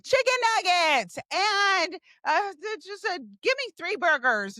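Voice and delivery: high, exasperated ordering voice; acted repeated order.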